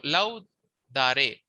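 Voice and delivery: laudare drawn out slowly, split after LAU; syllable by syllable. Shown as a demonstration. Teacher showing how accent works, laudare slowly broken up. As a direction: say laudare slowly, in separated parts. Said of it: "it depends on the accent".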